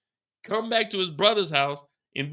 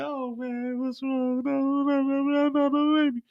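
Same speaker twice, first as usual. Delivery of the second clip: higher, hurried mock lament; Mireya's mock grief; 8 semitones higher than usual. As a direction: wail it higher, stumbling faster at the end. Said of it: "So Mireya is like".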